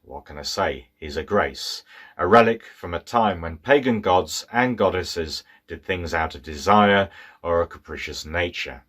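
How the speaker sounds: ordinary delivery, nothing marked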